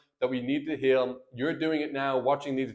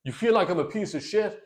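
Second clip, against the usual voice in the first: wrong, accusing tone; 5 semitones higher than usual. Shown as a demonstration. Husband firing wife's insult back in an indignant challenge. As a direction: challenge her, incredulous and combative. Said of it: "It's not like".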